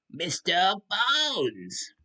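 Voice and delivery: high, drawn-out character voice; Mister Bones voice. Pretend. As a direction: stretch it out proudly. Self-introduction as Mister Bones.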